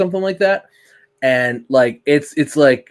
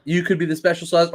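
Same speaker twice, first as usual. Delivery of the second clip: ordinary talk, nothing marked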